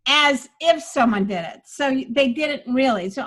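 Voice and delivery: ordinary talk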